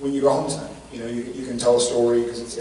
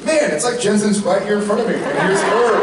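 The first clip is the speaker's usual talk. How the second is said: awed moviegoer; 9 semitones higher, 4 dB louder than usual. Retold or imitated. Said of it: "someone's like".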